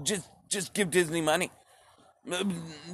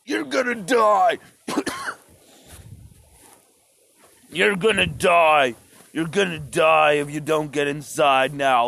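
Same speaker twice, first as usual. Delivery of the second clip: drone screaming. Retold or imitated. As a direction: blast the threat like a loudspeaker drone. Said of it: "drone comes at you screaming".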